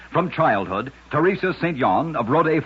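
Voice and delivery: ordinary talk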